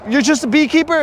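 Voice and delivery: high-pitched mock disbelief; imitated incredulous questioner. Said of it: "And then they'll be like".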